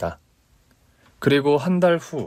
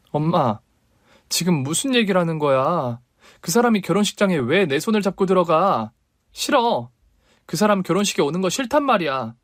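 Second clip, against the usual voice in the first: higher, rising angry protest; daughter refusing; 5 semitones higher than usual. Acted. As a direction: refuse her mother sharply.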